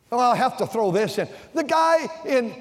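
high, loud, building preaching tirade; worked-up aside. Real, not acted. Preacher worked up mocking the reelected governor, high and loud. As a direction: let it rise into a heated jab.

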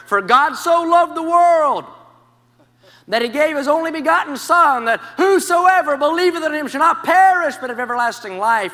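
ringing street-preacher cry. Retold or imitated. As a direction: call the verse out like a voice from nowhere. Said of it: "I heard from out of nowhere".

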